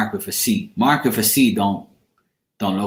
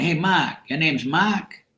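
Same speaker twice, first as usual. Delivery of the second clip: higher Boston imitation, R dropped in Mark; Boston accent; 4 semitones higher, 3 dB quieter, slower than usual. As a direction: mimic a curious Bostonian. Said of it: "They used to call me".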